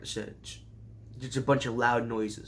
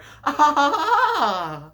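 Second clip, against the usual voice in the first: squealing laugh, much higher; high real laugh; 12 semitones higher, 8 dB louder than usual. Real, not acted. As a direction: break into a high, loud laugh. Laughing at own joke.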